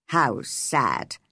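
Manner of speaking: low, sombre man; sorrowful low-pitched remark; man's voice. A narrator's reading. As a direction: regret it in a deep man's voice. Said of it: "she heard men's voices".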